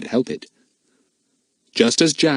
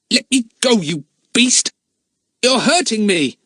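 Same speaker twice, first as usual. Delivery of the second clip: struggling shout; 12 semitones higher, 4 dB louder than usual. Acted. Boy struggles in a grip.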